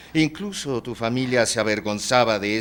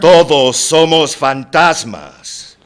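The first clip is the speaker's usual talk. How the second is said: loud, high, drawn-out declaration; emphatic proclamation; 7 semitones higher, 13 dB louder, slower than usual. Acted. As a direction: proclaim it slowly at full force. Emphatic answer to insistent question. (proclamación fuerte, aguda y alargada)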